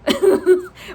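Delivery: loud, acted-out breath; steadying sigh. Student stopping to relax before parallel parking, big sigh. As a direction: let out a big calming breath. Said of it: "and I just went".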